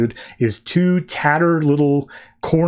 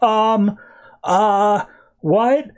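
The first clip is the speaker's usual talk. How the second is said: high, drawn-out bewilderment; baffled kid; 7 semitones higher, much slower than usual. Retold or imitated. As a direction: imitate a dumbfounded kid, pitched up. Dumbfounded kid reacts to Superman.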